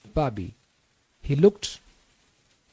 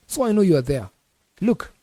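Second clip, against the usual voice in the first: irritated caller; 6 semitones higher, 5 dB louder than usual. A narrator's reading. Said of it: "already irritated".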